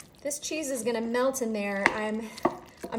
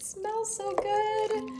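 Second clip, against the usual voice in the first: excited; 11 semitones higher, much slower than usual. Real, not acted. Smelling the food cooking.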